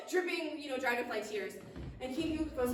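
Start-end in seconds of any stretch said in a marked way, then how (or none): none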